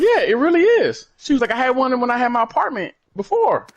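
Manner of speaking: put-on high, insistent girlfriend voice. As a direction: insist it's normal. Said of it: "And she was like".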